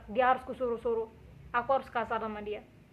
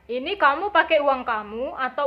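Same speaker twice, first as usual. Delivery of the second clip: louder, firm confronting questions; stern challenge; 4 semitones higher, 9 dB louder than usual. Retold or imitated. Girlfriend questioning the rich boy about the ring money, stern and loud. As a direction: demand to know whose money it is.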